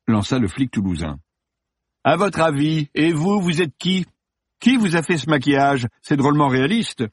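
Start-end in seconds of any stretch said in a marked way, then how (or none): 2.0-7.1 high-pitched actor's sarcastic comeback; irritated sarcasm. Acted. Actor to an intruding cop on set, irritated sarcasm. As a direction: toss it back, raised and exasperated.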